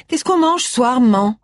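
light daughter voice, casual; lightly voiced daughter. A narrator's reading. Tracy asks her mother about supper, lightly voiced.

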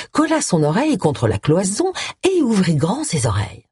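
usual speaking voice

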